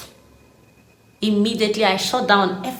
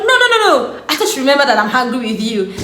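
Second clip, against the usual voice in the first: high laughing no no no, then fast; giggling protest to herself; laughing denial; 5 semitones higher, 5 dB louder, faster than usual. Retold or imitated. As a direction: laugh it off in a rush. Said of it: "I'm like".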